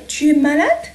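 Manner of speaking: high, "malade" rising sharply at the end; teacher demoing rising question tune; question intonation. Shown as a demonstration. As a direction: turn "Tu es malade" into a question with a steep lift on "malade". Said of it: "أغير فقط نبرة صوتي لكي أطرح السؤال".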